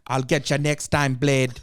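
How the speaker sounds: put-on hammy Jamaican accent villain taunt; a loud, hammed-up Jamaican villain; fake Jamaican accent. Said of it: "Fake Jamaican accent".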